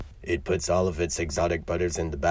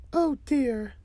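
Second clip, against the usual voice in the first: very high, louder mother character voice; worried mother; 6 dB louder than usual. Acted. Mother reacting to news of murder, very high voice. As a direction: pitch far up on the exclamation. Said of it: "says the mother".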